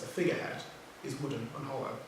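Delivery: normal talking voice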